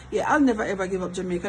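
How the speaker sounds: ordinary delivery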